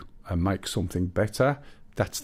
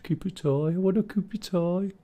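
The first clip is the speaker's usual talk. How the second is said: high broad Brummie caricature with a rising end; 10 semitones higher than usual. Retold or imitated. Streamer mocking a Brummie asking for tea, broad accent. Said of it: "how your very broad Brummie sound".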